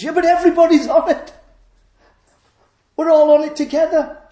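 high, loud crowd retort; shouting back. Acted. Crowd on the broad road answering the voice, shouting back. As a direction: call back over the crowd.

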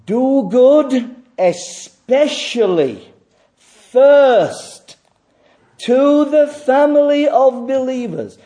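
loud, high, slow exhortation; fervent preacher's call; impassioned preaching. Acted. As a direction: proclaim it like a command from the pulpit. Preaching at full force.

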